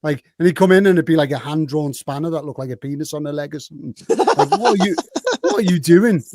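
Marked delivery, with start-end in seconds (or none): 4.1-6.2 laughing disbelief. Retold or imitated. Retold seeing mates' drunk tattoos.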